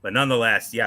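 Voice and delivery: ordinary talk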